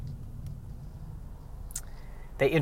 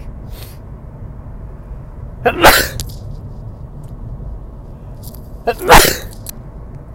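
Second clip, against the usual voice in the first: mock cough; 14 semitones higher than usual. Senator coughs in scene.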